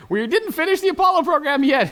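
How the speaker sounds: high, loud, laughing outburst; disbelieving laugh-shout. Real, not acted. Gamer realizing his blunder, loud laughing outburst. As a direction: blurt it out in amused disbelief.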